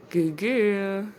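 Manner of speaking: loud praise for the dog; praising a pet. Real, not acted. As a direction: cheer the dog on.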